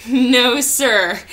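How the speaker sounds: mock stern no, sir; firm refusal. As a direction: scold the muse, laughing. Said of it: "And I'm like".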